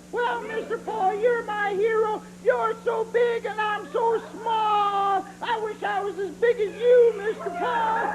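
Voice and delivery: high whiny doll voice, near crying; mock sobbing puppet voice; Mister Bill doll voice. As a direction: squeak out pitiful hero worship. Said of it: "who is your hero".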